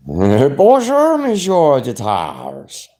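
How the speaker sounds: put-on playful French accent; mock French greeting. Greeting his friend with a mock French bonjour.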